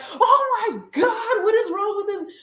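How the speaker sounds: high-pitched mock freak-out; retold screaming. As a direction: shriek in horrified disbelief, reenacting a reaction.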